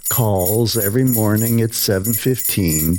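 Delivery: ordinary delivery, nothing marked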